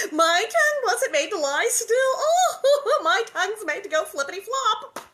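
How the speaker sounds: high, loud, giggling chatter; giggling Nellie. Acted. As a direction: giggle through the words. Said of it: "went on with a giggle".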